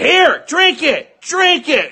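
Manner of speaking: high, stretched-out mock yell; imitated yelling. Retelling a mother yelling at her choking son, imitated yelling. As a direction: mimic frantic yelling with the pitch way up. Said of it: "she's literally yelling at him".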